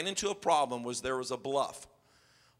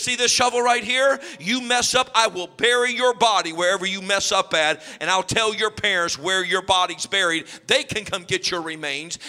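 mock threat; 2 semitones higher, 6 dB louder than usual. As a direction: boom it out like a camp tyrant pretending to kill. Pastor threatens kids with shovel.

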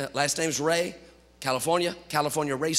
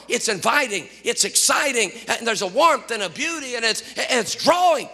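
raised, high preaching voice; 6 semitones higher, 3 dB louder than usual. Acted. Pastor stirring the congregation, full preaching voice. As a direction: preach it to the pews.

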